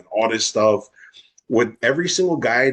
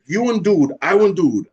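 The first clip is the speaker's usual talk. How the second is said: higher-pitched, worked-up retort; retold angry confrontation; 7 semitones higher than usual. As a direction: act out confronting a cheating boyfriend.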